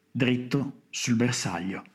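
usual speaking voice

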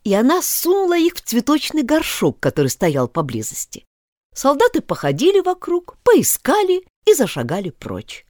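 ordinary delivery, nothing marked

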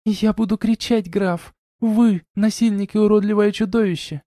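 high-pitched frightened, outraged woman; indignant lady. A narrator's reading. Wife threatening to scream at the count, indignant female voice.

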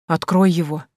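ordinary delivery

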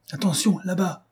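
high-pitched cry of alarm; alarmed warning. A narrator's reading. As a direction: warn the driver of danger ahead. Passenger warns of danger ahead.

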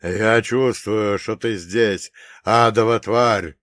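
slow, loud and hoarse; hoarse booming voice. Acted. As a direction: boom it out through a hoarse throat. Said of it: "гулко произнёс священник охрипшим голосом". (гулко, охрипшим голосом)

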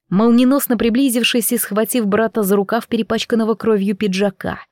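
usual speaking voice

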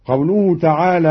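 ordinary talk

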